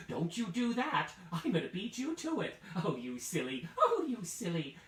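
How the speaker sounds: high, loud mock scolding; playful teasing old man. Old man teasing his wife over the gingerbread. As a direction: tease like a cheeky grandpa.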